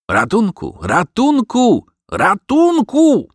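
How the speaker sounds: high, drawn-out cries for help; shouting. Acted. Dog calls under master's bedroom window for help, shouting. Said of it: "krzyczał Garm".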